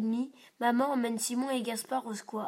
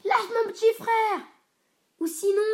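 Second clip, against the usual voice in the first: much higher, louder protective yell; shouting; 10 semitones higher, 8 dB louder than usual. A narrator's reading. Simon yells at the bully by the sandbox. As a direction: shout to defend his little brother. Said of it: "et crie".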